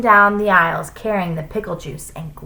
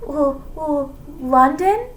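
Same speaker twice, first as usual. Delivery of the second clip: high, stammering guess; nervous; 9 semitones higher, slower than usual. A narrator's reading. Threatened pupil answering the teacher, nervous stammer. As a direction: stammer the first sound, then guess timidly. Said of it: "Leslie said nervously".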